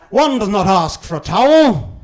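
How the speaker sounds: mock upper-class English; posh English. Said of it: "not the correct pronunciation".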